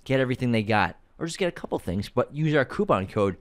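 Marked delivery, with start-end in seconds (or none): none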